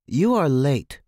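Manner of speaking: a loud, stern growl at the latecomers. A narrator's reading. Maria scolding the late girls, loud growl. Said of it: "Maria growled".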